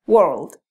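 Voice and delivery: "world" said clearly as a model; model pronunciation. Shown as a demonstration. Teacher modelling the word "world" for students. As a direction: say "world" slowly and cleanly. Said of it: "Entonces la palabra es".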